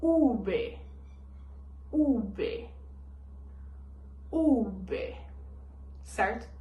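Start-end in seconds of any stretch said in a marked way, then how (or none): 0.0-5.2 U B said slowly and clearly; U B, each letter distinct; Spanish letter name. Shown as a demonstration. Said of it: "em espanhol eles falam".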